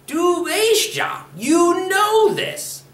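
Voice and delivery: high, slow, stressed "weißt ja"; exasperated emphasis. Shown as a demonstration. Teacher shows the inflection difference: slow, high, fed up. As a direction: drag it out, fed up and insistent. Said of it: "that inflection difference".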